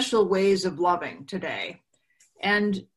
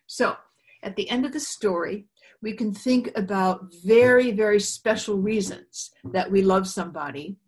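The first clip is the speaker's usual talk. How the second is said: normal talking voice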